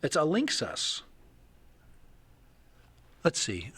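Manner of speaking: ordinary talk, nothing marked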